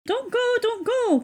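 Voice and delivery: high, loud seagull cry. A narrator's reading. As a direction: shriek a warning like gulls. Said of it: "cried the seagulls".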